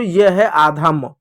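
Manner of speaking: ordinary talk, nothing marked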